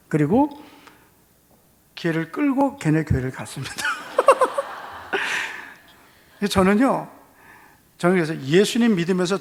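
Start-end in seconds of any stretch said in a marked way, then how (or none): 3.8-5.6 a real high burst of laughter. Real, not acted. Pastor laughing at his own story before congregation, high laugh. Laughs after dragging friend.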